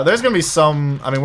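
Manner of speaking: ordinary talk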